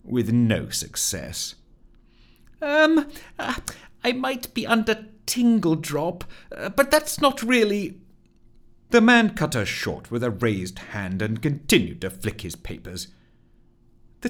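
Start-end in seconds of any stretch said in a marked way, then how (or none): 2.6-8.0 high-pitched, hesitant fluster; nervous high character voice; flustered Rusty. Acted. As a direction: stammer it high, unsure of yourself. Rusty stammers to the clerk.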